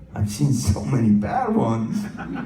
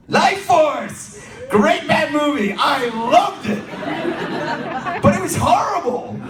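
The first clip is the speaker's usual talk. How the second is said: excited gushing; slower than usual. Real, not acted. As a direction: gush about a guilty-pleasure movie. Gushing over a bad movie.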